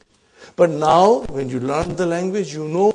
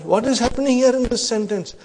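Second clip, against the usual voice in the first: ordinary talk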